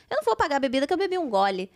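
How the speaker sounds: ordinary talk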